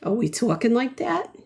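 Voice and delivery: ordinary talk, nothing marked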